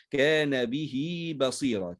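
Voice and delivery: slow recitation, "به" stretched to bihii; ha joined with ya. Shown as a demonstration. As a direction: draw the i of "به" into a long ya. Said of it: "فنصلها بياء".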